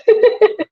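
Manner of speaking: sudden high laugh at her own slip; high burst of laughter. Real, not acted.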